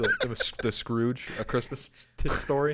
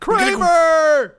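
Kramer shout; 9 dB louder than usual. Pretend. Seinfeld bit, calling out.

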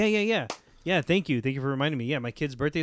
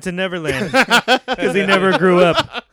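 higher and louder, laughing through the punchline; laughing Peter Pan joke; 5 semitones higher, 8 dB louder than usual. Retold or imitated. Cohost voicing Peter Pan's joke, laughing through it. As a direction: crack the joke while barely holding in a laugh. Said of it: "and he goes".

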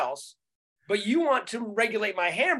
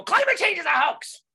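high shouted mockery; mock denier shout; 9 semitones higher, 3 dB louder than usual. Mocking a sudden climate denier, shouted high. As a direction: yell it like a conspiracy nut. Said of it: "doesn't make me go".

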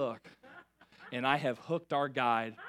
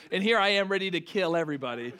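ordinary talk, nothing marked

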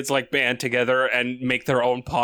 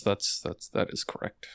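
whispered, much softer aside; whispered agreement; 8 semitones lower, 10 dB quieter than usual. Real, not acted. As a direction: let it fade to a whisper. Mock agreement trailing off.

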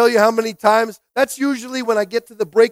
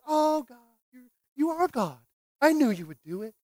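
softer, higher gush of wonder; mock relieved praise; 4 semitones higher, 6 dB quieter than usual. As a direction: imitate fickle believers suddenly awestruck. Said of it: "we go like".

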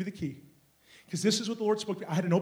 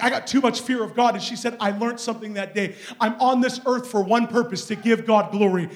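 building to a high, loud shout; impassioned pulpit cry, mounting; full-force preaching; 6 semitones higher, 11 dB louder than usual. Real, not acted. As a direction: drive it home to the congregation.

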